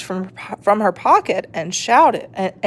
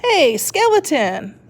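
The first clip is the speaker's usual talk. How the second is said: slow, higher called greeting; calling out; 8 semitones higher than usual. A narrator's reading. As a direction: call across the room. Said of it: "the invisible man called".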